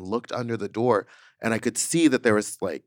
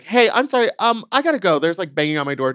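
urgent; 8 semitones higher, 7 dB louder, faster than usual. Retold or imitated. Rushing off phone interview.

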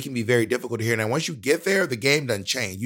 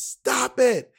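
high-pitched acted yell; mock outburst, voice shooting up; Tyra yelling; 13 semitones higher, 4 dB louder than usual. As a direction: yell it as a famous host losing patience. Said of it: "right when she yells".

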